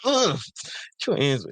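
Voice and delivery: high squeal of disgust, ugh; mock disgusted girlfriend pushing him away; disgusted woman. Mimicking woman rejecting man.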